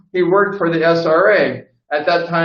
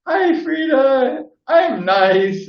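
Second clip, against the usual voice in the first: sweet talking; 10 semitones higher, slower than usual. Retold or imitated. Sucking up to scary boss.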